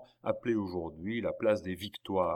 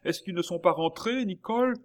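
high, loud worried question; agitated cry; 10 semitones higher, 6 dB louder than usual. A narrator's reading. Baron asking the maid after his children, crying out in agitation. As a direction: call the question up high, shaken. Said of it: "s'écria le baron, un peu ému".

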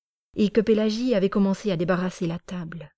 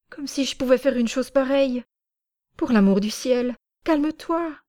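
frightened pleading; 6 semitones higher than usual. A narrator's reading. Accused man pleads innocence.

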